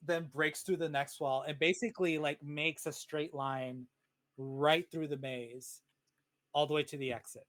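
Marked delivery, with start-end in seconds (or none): none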